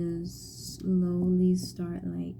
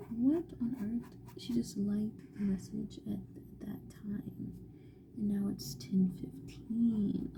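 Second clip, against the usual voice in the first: whisper; 6 dB quieter than usual. Real, not acted. Painting vlog, soft whispered talk.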